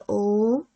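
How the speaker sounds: ओम with the ओ stretched long and slow; ओम chanted long to show a lengthened vowel; drawn-out vowel. Shown as a demonstration. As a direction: hold the ओ in ओम well past a normal length. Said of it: "उसका उच्चारण लंबा जाता".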